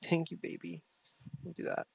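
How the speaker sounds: put-on soft, quiet cooing baby talk; hushed baby-talk thank you; cute baby voice. Shown as a demonstration. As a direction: coo it softly like to a cute baby. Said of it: "How do you say it's like a cute baby".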